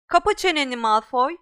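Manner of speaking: put-on loud snapping girl's voice; angry retort. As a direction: tell him to shut up. Girl telling bully off.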